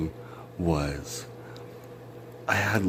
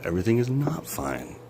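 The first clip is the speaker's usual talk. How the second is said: normal talking voice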